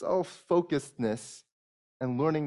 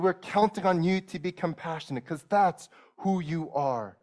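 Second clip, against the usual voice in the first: higher, louder, pressing plea; fervent pleading; 6 semitones higher, 4 dB louder than usual. Real, not acted. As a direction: urge God on, with rising fervour. Preacher building in fervent plea.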